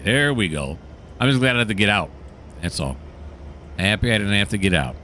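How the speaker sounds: ordinary delivery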